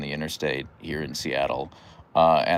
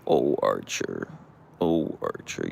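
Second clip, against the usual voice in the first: low tender sigh; 10 semitones lower, slower than usual. Real, not acted. Soothing her dog.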